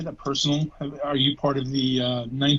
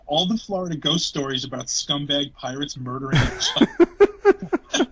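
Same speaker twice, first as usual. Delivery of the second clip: laughing; 2 semitones higher than usual. Real, not acted. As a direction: crack up at the absurd contrast. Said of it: "I'm laughing at the fact".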